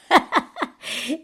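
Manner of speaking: high, loud witchy cackle; evil laugh. A narrator's reading. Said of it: "Mona lachte". (hohes, böses Hexenlachen)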